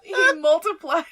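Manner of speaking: loud, high, breaking into laughter; laughing. Real, not acted. Game master cracks up narrating the stabbing attack, laughing. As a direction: squeeze the words out through laughter.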